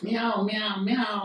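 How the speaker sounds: storyteller's light cat imitation; cat meow. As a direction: mimic a cat mewing.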